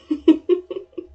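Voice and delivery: loud, high cackle of scorn; villain's scornful cackle at the family; evil laugh. Acted. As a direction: cackle with contempt.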